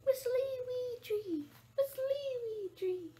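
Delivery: high, sing-song bird call gliding down; imitating a starling's falling whistle. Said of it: "That's a starling".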